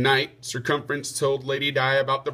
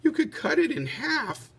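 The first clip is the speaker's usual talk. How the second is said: Lady Di's voice; 12 semitones higher than usual. Acted. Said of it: "said Lady Di".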